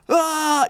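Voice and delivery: high-pitched imitation scream; drawn-out high "aah" of fake terror; mock panicked scream. Imitating people running out in panic.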